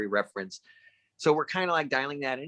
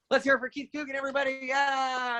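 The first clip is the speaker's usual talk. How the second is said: hyped-up crowd intro; 12 semitones higher than usual. Retold or imitated. Director firing up the crowd for an actor, high excited whoop. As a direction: pump up the whole crowd like a hype man.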